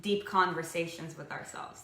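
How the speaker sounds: ordinary delivery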